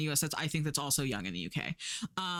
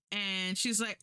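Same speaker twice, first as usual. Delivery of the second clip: usual speaking voice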